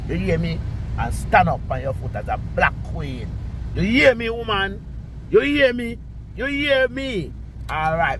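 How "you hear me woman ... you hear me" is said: high insistent demand. Real, not acted. Repeating demand at Queenie.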